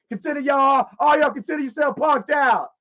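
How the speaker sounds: high, crowing announcement; cocky boast. Retold or imitated. Heckler to the whole audience, cocky crowing. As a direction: crow it to a whole room.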